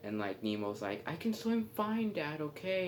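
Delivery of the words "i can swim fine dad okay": put-on high-pitched defiant little kid. Nemo talks back to his father, high defiant kid voice. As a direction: push back at Dad in a high voice.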